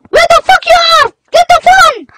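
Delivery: high, loud frantic shout; panicked yell. Acted. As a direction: scream in panic for the phone. Panicked search for phone.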